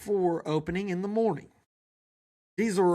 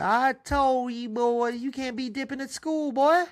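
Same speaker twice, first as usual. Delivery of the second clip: mom scolding; 7 semitones higher than usual. Acted. Mother scolding son about dipping, high mama voice. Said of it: "Dolly said".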